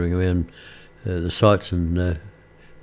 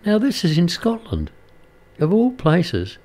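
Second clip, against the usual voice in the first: amazed; 9 semitones higher than usual. Real, not acted. Amazed at the coincidence.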